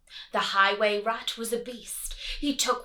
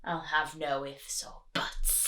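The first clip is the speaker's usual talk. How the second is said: snarl; 6 semitones lower, 5 dB quieter than usual. Acted.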